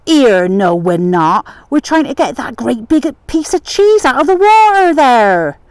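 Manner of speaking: loud, high, hammed-up West Country yokel voice; mock West Country yokel. Yokel fooling the excise men, loud mock West Country. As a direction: play the daft country bumpkin, loud and high. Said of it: "he played upon this".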